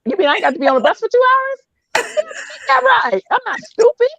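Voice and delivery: very high, comic incredulous squeal; hammed-up eager acceptance, laughing; eager realization. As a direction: pitch shoots up as the offer lands. Acts out jumping at the ride.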